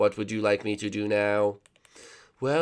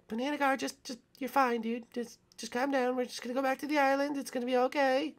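soothing; 6 dB louder than usual. Acted. Soothing panicked Banana Guard.

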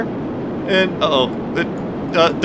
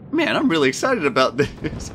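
ordinary talk

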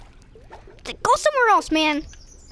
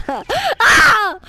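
startled shout; 4 semitones higher, 5 dB louder than usual. Real, not acted. Splashed into the pool.

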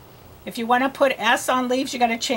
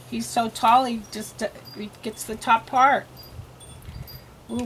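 usual speaking voice

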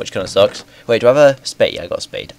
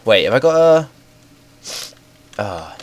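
usual speaking voice

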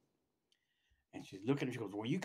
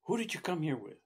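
higher retold demand; upset doctor; 5 semitones higher, 4 dB louder than usual. Doctor questioning a newly arrived patient, upset. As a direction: imitate a doctor questioning sharply. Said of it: "she's all upset".

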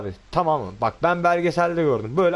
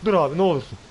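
usual speaking voice

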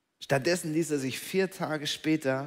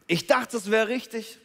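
higher and louder, eager; hopeful; 7 semitones higher, 6 dB louder than usual. Acted. Disappointed person recalling their certainty, high and eager. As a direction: sound sure of yourself, raised up.

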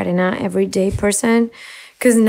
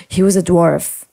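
usual speaking voice

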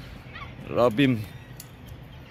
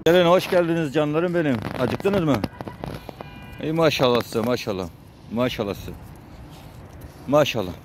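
higher, cooing pet talk; fond pet talk; 5 semitones higher than usual. Real, not acted. Feeding strays, praising and cooing at them, higher fond voice. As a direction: coo at the animals as they eat. Talking fondly to animals while feeding.

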